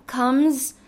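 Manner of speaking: ordinary talk, nothing marked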